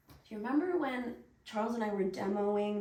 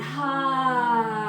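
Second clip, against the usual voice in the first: loud, sharp "Ha!" pushed out from the chest; release sound; 3 semitones higher, 14 dB louder than usual. Shown as a demonstration. Teacher showing the partner a release sound, loud. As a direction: let the breath burst out as a shouted release. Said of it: "release into the earth with a sound".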